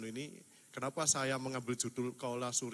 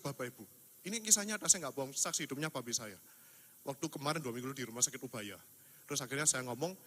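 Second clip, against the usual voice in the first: ordinary delivery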